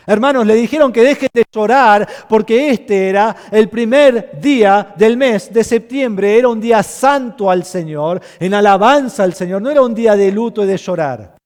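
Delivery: proclama alto, con fuerza de púlpito; predicación enérgica. Acted. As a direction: predica con fervor a la congregación. Preaching at full force. (predicador en pleno fervor ante la iglesia, voz fuerte)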